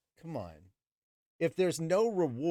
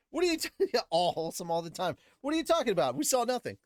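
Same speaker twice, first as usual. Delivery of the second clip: a laughing what-are-you-on-about, higher and quick; mock disbelief, cracking up; incredulous laugh; 6 semitones higher, faster than usual. Real, not acted. Host mocking the book's wholesome claim, incredulous laugh.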